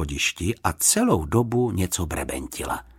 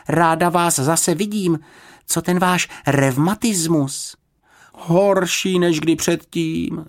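put-on higher, slow polite girl; a well-mannered girl greeting; voiced girl character; 9 semitones higher, slower than usual. A narrator's reading. Judy and housekeeper voiced.

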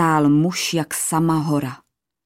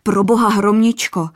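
higher and louder panicked outburst; alarmed blurt, higher and louder; blurted in fright; 5 semitones higher, 5 dB louder than usual. A narrator's reading. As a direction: cry out to save her. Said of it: "vyhrkl zděšeně".

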